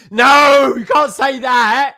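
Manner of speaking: high, loud cry of protest; alarmed shout. Real, not acted. Stopping co-host's spoiler, high alarmed shout. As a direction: shout it out to stop them.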